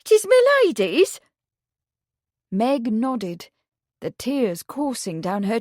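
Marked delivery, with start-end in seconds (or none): 0.0-1.2 high, loud, stunned question; disbelieving gasp; aghast. A narrator's reading.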